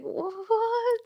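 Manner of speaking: high, drawn-out squawk; exaggerated retold disbelief; incredulous mimicry. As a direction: stretch it high in mock outrage. Mimicking angry man, hands up.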